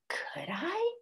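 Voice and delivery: high, soft, timid question; shy hope. A narrator's reading. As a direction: ask in disbelief. Wombat hardly believes it.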